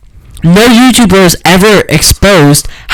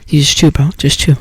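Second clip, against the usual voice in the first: quiet chewing demo; 2 semitones lower, 5 dB quieter than usual. Shown as a demonstration. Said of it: "you don't do the whole".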